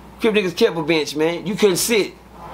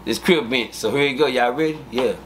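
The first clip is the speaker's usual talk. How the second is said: ordinary delivery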